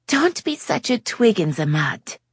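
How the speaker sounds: put-on high, French-accented teasing; lightly voiced French lady. A narrator's reading. Yasmin teasing Jake in a high French-tinged voice. Yasmin misuses an American phrase.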